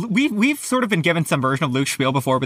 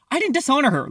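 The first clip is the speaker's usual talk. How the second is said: snapped retort; 9 semitones higher than usual. A narrator's reading. Luke answers Annabeth's accusation with an irritated snap. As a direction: snap back lightly, pitch raised. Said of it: "Luke snapped".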